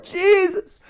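high, long-drawn plea. Acted. Shushed blind men yelling to Jesus, desperate cry. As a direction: stretch the cry out to carry over the crowd. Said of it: "They gotta yell even louder".

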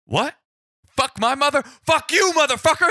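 very high, loud explosion of fury; outraged cop. Retold or imitated. Cop to caller over mother insult, outraged yelling. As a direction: erupt in disbelief, then cursing fury.

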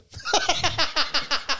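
real, high-pitched burst of laughter. Real, not acted. Laughing as the friend guesses right, a high laugh burst. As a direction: crack up at the guess.

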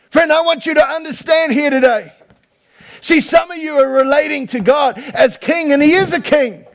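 loud, high, driving sermon voice; preaching at full force. Real, not acted. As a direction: preach it out to the room.

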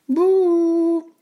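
very high, squeaky little character voice; gingerbread man's cry. A narrator's reading. Gingerbread man startles the old woman from the oven, high playful cry. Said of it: "cria le bonhomme de pain d'épices".

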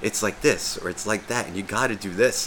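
imitated unruffled explainer; calm explaining. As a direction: explain it calmly, voice kept down. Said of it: "he does it so calmly".

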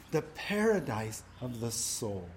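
low, soft and reverent; hushed, reverent. Real, not acted. Pastor savoring heaven's promise, soft and reverent. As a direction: let the phrase sink in softly. Preacher savoring key phrase.

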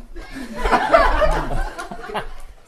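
loud laugh. Acted. Guru bursts into laughter.

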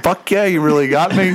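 high-pitched, half-amused mock protest; acted-out exclamation on the edge of laughter; imitating half-laughing outburst. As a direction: retell it as a hurt buddy kinda laughing. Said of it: "kinda laughing".

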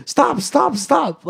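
high-pitched, urgent repeated order; excited retold cop yell; mock police command. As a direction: imitate cops shouting as they rush in. Undercover cops jumping out, commanding.

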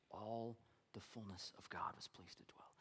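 low near-whisper, very soft; hushed repetition. Acted. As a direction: let the verse sink in quietly. Repeats verse for reflection.

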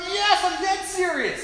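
high, drawn-out earnest retort; emphatic insistence. Retold or imitated. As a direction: insist on it, pitched right up. Retold insistent answer.

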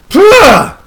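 sudden high, loud burst of "pah"; a comic gasp as if dropping dead on the spot; mock dying gasp. Mock death after grim prognosis.

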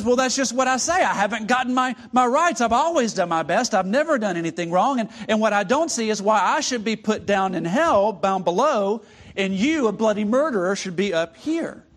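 high, loud, defensive ghost; a ghost demanding his rights; protesting ghost. Acted.

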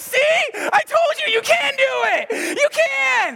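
high, loud triumphant crowing; excited told-you-so. Retold or imitated. Pastor reliving proving his staff wrong, triumphant high shout. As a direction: gloat like you just won the argument. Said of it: "I was like".

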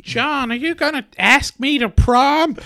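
put-on high, girly prom voice; high teen girl voice.

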